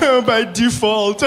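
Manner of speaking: high, drawn-out fake sob; hammed-up crying; mock wailing. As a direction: bawl the words slowly through pretend tears. Son wails at mother's joke.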